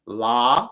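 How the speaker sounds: la with a wide open mouth; exaggerated la. Shown as a demonstration. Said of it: "sound funny".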